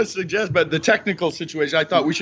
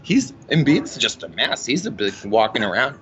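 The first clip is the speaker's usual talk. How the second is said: a hint of his mother, blunt and doting; imitating mom; 4 semitones lower than usual. Son voicing his mom calling Embiid a mess. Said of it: "she goes".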